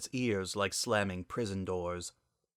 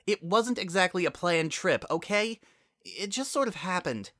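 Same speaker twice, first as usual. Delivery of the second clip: defensive; 10 semitones higher than usual. Acted. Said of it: "bit back a sharp retort".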